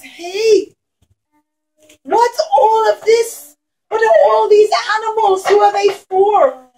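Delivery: high, amazed Esav voice; surprised Esav. Acted. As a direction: act out Esav gaping at the gifts. Teacher voices Esav surprised at gifts.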